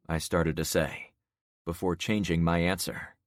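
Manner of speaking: ordinary delivery, nothing marked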